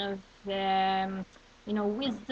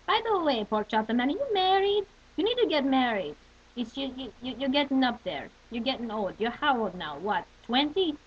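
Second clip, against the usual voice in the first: put-on Yenta accent. Yenta pestering pork chop man about marriage, in a Yiddish accent. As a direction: play the Yenta nagging someone to marry. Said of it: "Yenta is my favorite".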